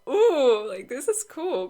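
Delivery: loud, high delighted "ooh"; impressed reaction. Acted. Deck creator voicing an impressed viewer, excited. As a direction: gush as if wowed.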